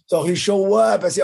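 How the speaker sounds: real laugh breaking through the line. Real, not acted. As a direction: burst out laughing as you say it. Laughing at his own story.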